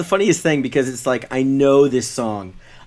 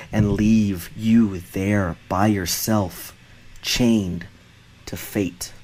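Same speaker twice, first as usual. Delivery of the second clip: low, slow solemn recital; dramatic reading; 6 semitones lower, 3 dB quieter than usual. Acted. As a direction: intone lyrics like a grave poem. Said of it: "dramatic read".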